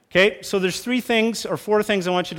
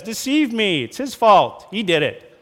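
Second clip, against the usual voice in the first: defensive Eve; 5 semitones higher, 4 dB louder than usual. Retold or imitated. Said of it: "Eve says".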